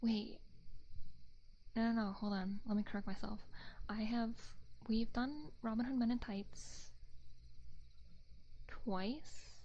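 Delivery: normal talking voice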